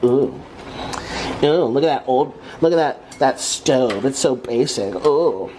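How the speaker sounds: mock the old stove like a snob. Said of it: "make fun of my apartment".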